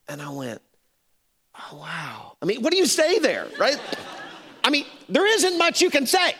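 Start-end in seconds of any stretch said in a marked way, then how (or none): 1.5-2.4 very soft, stunned "oh, wow"; subdued reply. Retold or imitated. Pastor lost for words to hairdresser, softly stunned. 2.4-6.3 high, loud, helpless protest; comic exasperation. Real, not acted. Preacher appeals to congregation about awkward moment, high and exasperated.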